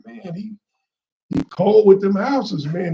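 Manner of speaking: impressed praise. Retold or imitated. Man praising brother, retold.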